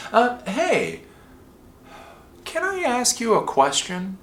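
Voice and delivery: high, tentative innocent ask; help desk newbie. Acted. New guy asking the sysadmin a question, high and tentative. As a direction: pitch it up like a harmless newcomer. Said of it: "I'm the new guy on the help desk".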